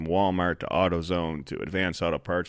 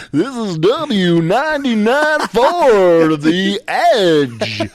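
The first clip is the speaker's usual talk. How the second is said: loud, high, drawn-out DJ station ID; booming slow-stretched radio announcer hype; radio disc jockey; 11 semitones higher, 9 dB louder, much slower than usual. Acted. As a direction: belt out the call sign like a hyped radio jock. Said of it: "pretend I was a disc jockey".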